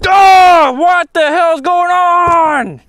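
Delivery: high, loud, slow exasperated cry; exasperated outburst. Real, not acted. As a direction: drag it out in loud disbelief. Line broke off again.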